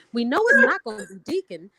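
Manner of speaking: high, loud pleading insistence. Shown as a demonstration. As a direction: plead like you need it true. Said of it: "this is my pleading voice".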